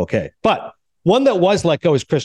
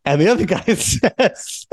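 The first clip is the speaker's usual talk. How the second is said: laughing; 4 semitones higher, slower than usual. Real, not acted. Cracks up telling his story.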